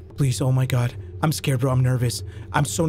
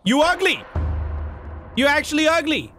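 high-pitched mocking jeer; gleeful high taunt; 8 semitones higher than usual. Real, not acted. Taunting the monster.

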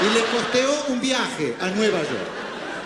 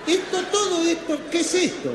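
high, mounting babble of muddled syllables; hammed-up verbal meltdown; tongue-tied frenzy; 8 semitones higher than usual. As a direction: get hopelessly tangled, pitch up, ending in a cry. Garbles closing line into frantic babble.

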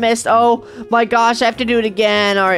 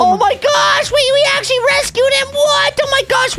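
high-pitched overjoyed squeal; hammed-up, high-pitched joy; amazed excitement; 11 semitones higher than usual. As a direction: squeal in thrilled disbelief. Thinks Daddy has been rescued.

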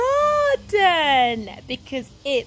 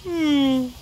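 long falling drowsy sigh; sleepy sigh. Acted. Ted feeling very sleepy, drowsy sigh. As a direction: sigh and hum as if nodding off. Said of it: "who is feeling very sleepy".

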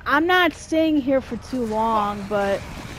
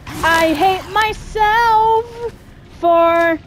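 drawn-out wail; 7 semitones higher, 5 dB louder, much slower than usual. Real, not acted. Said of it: "I fucking hate myself".

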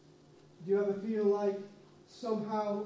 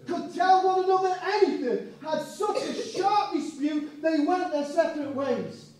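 louder, higher preacher's peak; preaching at full force; 6 semitones higher, 7 dB louder than usual. Acted. Preacher telling the congregation of the split, loud and rising. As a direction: hit the sharp dispute hard.

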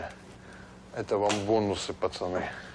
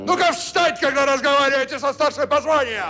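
high commanding shout; barking order; 7 dB louder than usual. Acted. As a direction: bark like a drill officer. Kaban barks orders as major.

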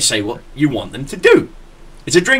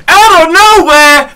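much higher, louder, slow announcer yell; wrestling announcer shout; 9 dB louder, slower than usual. Retold or imitated. Calling the surprise slam at work, announcer shout. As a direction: bellow it like a wrestling commentator. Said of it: "and I went".

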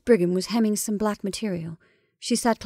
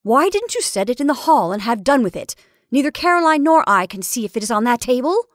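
loud, raised-pitch scolding; impatient; 4 semitones higher, 6 dB louder than usual. A narrator's reading. Mrs Brigham scolding her sister over the lamp, impatient. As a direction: complain impatiently, voice up and forceful. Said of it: "with more of impatience than her voice usually revealed".

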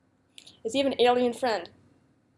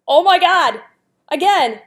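loud, higher cry of frustration; louder exasperated yelp; exasperated outburst; 5 semitones higher, 10 dB louder, slower than usual. Real, not acted. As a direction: blurt it out, fed up with the repeats. Akinator repeats a question again.

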